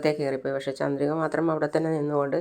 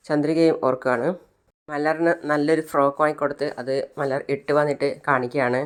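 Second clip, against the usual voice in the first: ordinary talk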